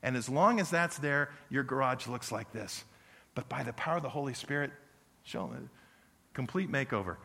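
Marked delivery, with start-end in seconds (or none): none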